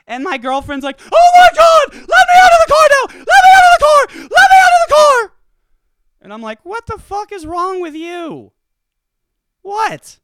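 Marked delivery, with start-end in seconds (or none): 1.0-5.3 very high, loud, panicked shriek; hammed-up high-pitched screaming mimicry; mimicking girlfriend. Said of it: "the voice I'm gonna do when I'm mimicking my girlfriend". 6.6-10.2 higher, drawn-out incredulous comeback; acted-out baffled indignation; incredulous retort. Acted retort to screaming girlfriend.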